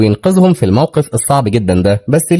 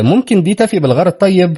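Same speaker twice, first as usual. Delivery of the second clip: higher-pitched, lightly mocking question; 9 semitones higher than usual. A narrator's reading. Deckhand offers rich guest a tiny bike, lightly mocking. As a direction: feign helpfulness with a touch of mockery. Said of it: "هو كان بيتريق على أمبر".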